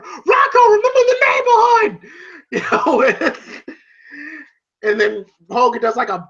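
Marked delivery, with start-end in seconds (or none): 0.0-2.1 very high, loud holler; hammed-up strained shout from ringside; Paulie's yell. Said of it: "You hear Paulie going".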